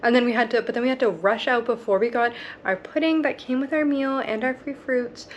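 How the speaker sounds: normal talking voice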